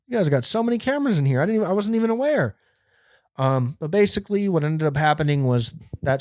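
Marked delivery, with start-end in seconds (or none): 0.1-2.6 high, rapid retold disbelief; mock amazement. Teen watching the store's video, high fast mock amazement. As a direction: race through it high, acting astonished. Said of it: "and I was like".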